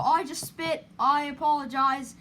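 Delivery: ordinary talk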